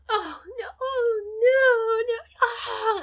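high, whimpering "oh no"s; mock wailing. Meg Ryan's character wailing over lost things, mock sobs. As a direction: fake a little sob. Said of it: "Meg Ryan's going".

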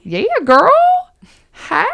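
put-on Southern accent. Host cheers co-host's right answer in a Southern accent. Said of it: "in my Southern accent".

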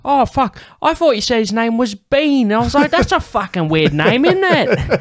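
very high, squawking Cockney impression; hammed-up Cockney, shrieky and incredulous. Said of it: "This Cockney English guy?".

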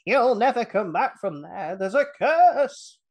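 slow, rising mock warning of a curse; Crazy Ralph voice. Host imitating Crazy Ralph's warning to campers. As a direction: ham up the doom-laden old man. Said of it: "That's just what he sounded like".

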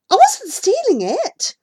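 high, loud indignant denial; indignant protest. A narrator's reading. Accused child answering the goat's owner, indignant protest. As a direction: protest your innocence loudly.

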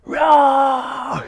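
full shouted high primal roar; loud roar. Retold or imitated. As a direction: roar it out from the gut. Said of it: "felt this thing building inside".

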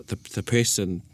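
normal talking voice

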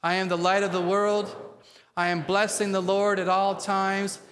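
rousing, measured confession led for the congregation; attitude of faith. Shown as a demonstration. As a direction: declare each line boldly, with spunky conviction. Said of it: "say it today with an attitude of faith".

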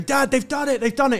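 high-pitched, urgent call to Dad; re-enacted excited kid's shout; retold excited calling. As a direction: call out the good news, voice jumping up. Retelling excited calls to dad.

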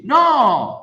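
sharp loud no; shouted no. Acted. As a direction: shout it down forcefully. Rebutting the quoted client.

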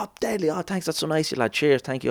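high, soft, gushing mock thanks; imitating grateful band. Band thanking him for snacks, high imitated gushing.

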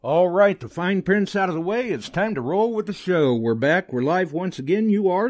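high, fast, pumped-up host; excited show opener. Real, not acted. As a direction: kick off the show with energy.

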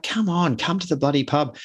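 insistent coaxing. Acted. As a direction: pester a reluctant friend to join in. Mates pressing reluctant friend.

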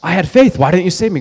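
high, loud, aggrieved complaint; the man in heaven protesting to God, mock outrage; mock angry at God. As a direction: ham up the indignant man confronting God. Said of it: "he's kinda mad at God".

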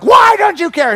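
high, loud panicked cry at God; retelling a panic attack, crying out to the Lord in alarm; desperate outcry. Retold or imitated. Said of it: "and I'm like".

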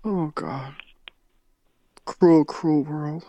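overdone soft mourning for Betty; hammed-up mourning. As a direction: sigh out a theatrical grief.

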